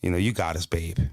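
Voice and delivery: lightly voiced reassuring wife; wife's reassurance. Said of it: "That's what she said".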